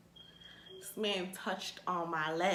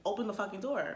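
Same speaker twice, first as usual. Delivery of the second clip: ordinary talk, nothing marked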